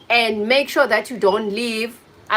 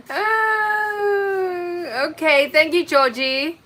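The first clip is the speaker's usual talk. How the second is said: laughing; 8 semitones higher, much slower than usual. Real, not acted. Moved by a viewer's message.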